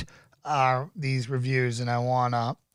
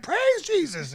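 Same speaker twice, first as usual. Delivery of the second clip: much higher, fervent Southern churchgoer; eager church praise; slower than usual. Retold or imitated. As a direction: cry out praise like a devout Southern crowd. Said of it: "having everyone being like".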